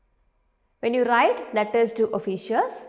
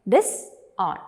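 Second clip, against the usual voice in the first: "disown" split in two with a pause; syllable by syllable; 2 semitones lower than usual. Shown as a demonstration. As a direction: break "disown" into dis, then own. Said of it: "You can break down this word".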